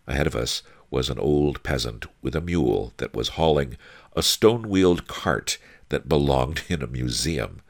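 plain reading voice

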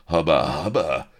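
loud wolfish catcall; leering cries. A narrator's reading. Pirates jeering at the lady on deck, leering cries. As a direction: call it out lustily. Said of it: "cries of".

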